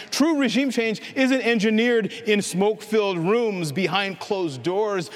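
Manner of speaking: usual speaking voice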